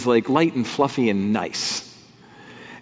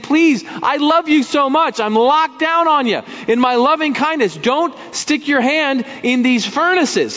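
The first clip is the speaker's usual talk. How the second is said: voicing God pleading; 12 semitones higher than usual. God warning his children, loving pleading voice.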